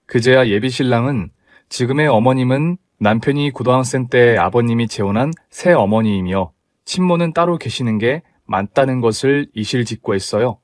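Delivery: ordinary delivery, nothing marked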